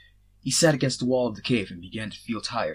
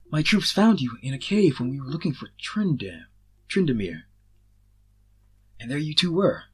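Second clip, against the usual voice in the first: a hint of a higher woman's voice, louder; lightly voiced woman; 5 semitones higher, 6 dB louder than usual. A narrator's reading. Ash telling Azrael how he was found, lightly voiced woman. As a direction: voice Ash a little higher and brighter.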